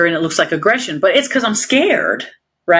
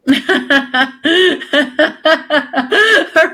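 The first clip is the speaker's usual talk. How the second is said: incredulous laugh; 9 semitones higher than usual. Real, not acted. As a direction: laugh it off in disbelief.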